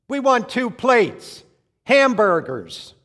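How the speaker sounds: loud, slow, high-pitched shouting at the waiter. Retold or imitated. Husband shouting slowly at a Greek waiter. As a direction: spell it out loudly and slowly to a foreigner. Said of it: "Honey, why are you shouting?".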